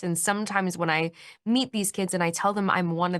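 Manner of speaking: usual speaking voice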